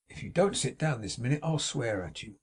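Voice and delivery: ordinary delivery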